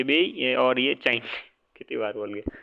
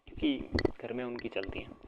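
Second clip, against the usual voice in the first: वो भी इसमें whispered as a joke aside; 3 semitones lower, 12 dB quieter than usual. Real, not acted. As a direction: let the teasing aside about wives drop to a whisper. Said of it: "joking whisper aside". (मज़ाक में फुसफुसाकर)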